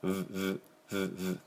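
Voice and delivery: soft, short buzzing V pulses; phone vibration buzz. Shown as a demonstration. Teacher likening V to a phone, soft vibrating buzzes. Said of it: "携帯バイブの音".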